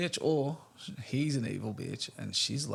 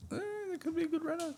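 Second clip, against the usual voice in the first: high, fast squeaky put-on voice; mock high voice; 6 dB quieter, much faster than usual. Host mocks the renovation excuse in a high fast squeaky voice. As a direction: squeak it out in a silly mocking voice.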